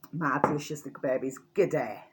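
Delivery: bad Australian accent. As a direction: fumble an Australian greeting, admittedly badly. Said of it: "not very good at doing the old, uh, Australian accent".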